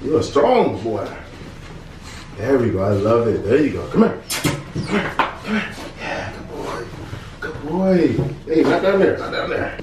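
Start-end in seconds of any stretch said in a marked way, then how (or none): 3.9-9.0 calling the dog in a high, sing-song voice; high pet voice. Real, not acted. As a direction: coax the puppy over, pitched up high.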